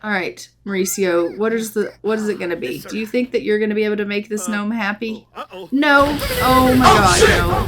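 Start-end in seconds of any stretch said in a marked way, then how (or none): 5.7-7.7 loud, raised cries of dismay; alarmed shout. Real, not acted. Player watching lightning hit another character, alarmed shout. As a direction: shout out in helpless shock. Lightning strikes the second pleader.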